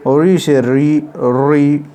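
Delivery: री से री drawn out slowly and clearly; teacher spells out the trick for students, slow and clear; slow demo. Shown as a demonstration. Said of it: "shows the short trick".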